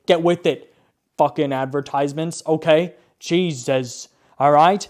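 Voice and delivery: scolding Domino's over its ad, loud and exasperated; exasperated rant. Real, not acted. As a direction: snap at the advertisers. Ranting at the ad.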